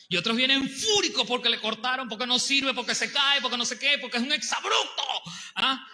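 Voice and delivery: high, loud, rising heat; preacher building to a shout; heated preaching. Real, not acted. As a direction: hammer out the complaints one by one. Angry customers described.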